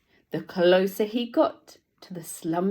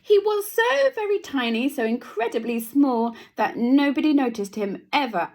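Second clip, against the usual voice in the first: tiny voice; 6 semitones higher, 4 dB louder than usual. A narrator's reading. Stressing mouse's smallness.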